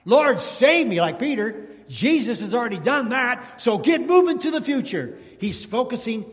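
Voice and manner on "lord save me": higher, louder plea for rescue; pleading cry. Retold or imitated. Believer calling on the Lord, loud pleading cry. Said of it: "come to him and said".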